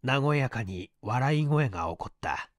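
usual speaking voice

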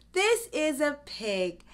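normal talking voice

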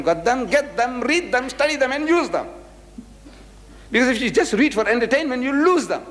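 high-pitched, urgent exhortation; impassioned preaching. Real, not acted. Preacher urging listeners to get and use books, impassioned. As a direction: drive it home to the crowd. Urges audience to get and study booklets.

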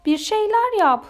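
high, urgent storybook cry; shouted plea. A narrator's reading. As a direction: cry out for someone to act. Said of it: "diye bağırdı diğer balıklar". (telaşlı, tiz bağırış)

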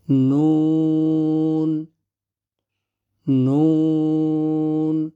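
slow, drawn-out নুন; Arabic nun. Shown as a demonstration. Teacher reciting nun to students, slow drawl. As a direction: let নুন ring long. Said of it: "teacher reciting letters".

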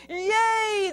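high, long crowd hurrah; drawn-out joyful yay; cheering. A narrator's reading. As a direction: cheer it out with relief. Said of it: "the crowd cheered".

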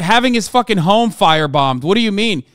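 ordinary talk

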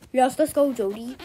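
usual speaking voice

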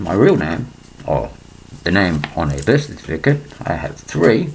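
ordinary delivery

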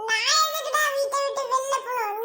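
indignant teary cry; tearful outburst. Acted. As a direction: wail it back at him, hurt and angry. Wife refuses to leave house.

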